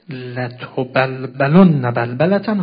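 very slow, loud declamation; sermon recitation. Acted. Preacher returning to what the Imam said, solemn recitation. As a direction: stretch the Arabic out with force. Recites the quoted Arabic phrase.

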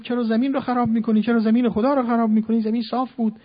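high, loud, fast indignant yelling; fool shouting. Retold or imitated. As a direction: berate the digger like an outraged fool. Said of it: "یه ابلهی داد زد".

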